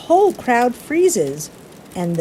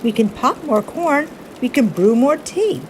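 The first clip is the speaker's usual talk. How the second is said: high squeaky little mouse soothing; 6 semitones higher than usual. Acted. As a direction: squeak it up high to calm the bear. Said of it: "Mouse squeaks".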